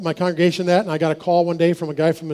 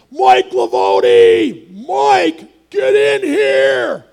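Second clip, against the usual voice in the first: high, loud mock yell, slowing right down on the last words; retold foreman yell; 10 semitones higher, 8 dB louder, much slower than usual. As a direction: bellow his name across the factory floor. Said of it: "and he yelled".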